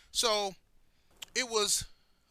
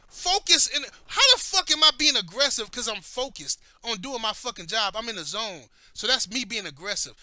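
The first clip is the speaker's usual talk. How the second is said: indignant retort; 3 semitones higher, 6 dB louder than usual. Retold or imitated. Man answering coworker who called him aggressive, indignant. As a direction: throw the word back in outrage.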